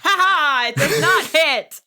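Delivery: high, loud triumphant crow; cocky victory laugh; gloating. Acted. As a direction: rub the miss in his face. Gloating after dodge.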